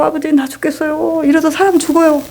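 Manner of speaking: retold pained plea. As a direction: beg like your stomach is killing you.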